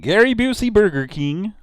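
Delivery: high, loud roll-call shout; big high-pitched announcer hail; announcing a name. Real, not acted. Calling out chat members' names.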